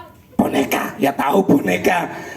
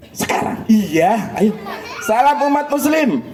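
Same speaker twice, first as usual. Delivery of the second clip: ordinary talk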